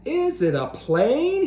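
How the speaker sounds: high rising question; curious. Acted. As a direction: wonder aloud. Splat guessing in the sky.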